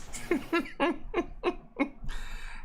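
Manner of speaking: silent wheezing laugh; breathy laugh. Real, not acted. Laughing at joke.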